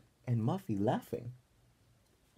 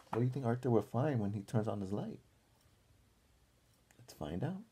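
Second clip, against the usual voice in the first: usual speaking voice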